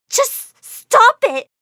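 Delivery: loud, high, slow cry of hurt; yelling. Acted. Chris yelling at the laughing crowd and cameras, loud and high. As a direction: scream it out, humiliated and fed up. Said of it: "I began to yell".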